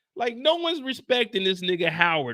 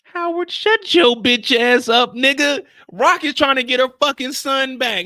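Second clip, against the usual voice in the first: yell at Howard; 5 semitones higher, 7 dB louder than usual. Real, not acted. Yelling at Howard onscreen.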